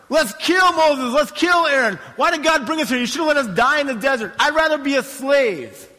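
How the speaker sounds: high, loud acted outcry; hammed-up furious complaining, voice pitched right up; mock mob outrage. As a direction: shout like a mutinous crowd. Angry mob of Israelites, acted.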